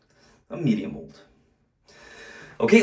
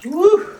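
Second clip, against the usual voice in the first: short high, loud "woo" of exertion; winded whoop; 7 dB louder than usual. Real, not acted. Host pausing after a burst of fast plunging, winded whoop. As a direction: let out a high puff of a whoop after hard work. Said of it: "I'm a little out of breath".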